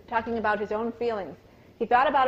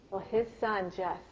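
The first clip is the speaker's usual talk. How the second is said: ordinary delivery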